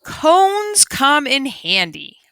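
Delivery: usual speaking voice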